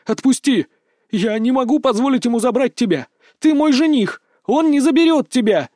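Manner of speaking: high, loud impassioned cries; desperate protest. A narrator's reading. Berta struggles in her fiancé's arms, crying out in protest. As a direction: pitch it high and fight to be let go.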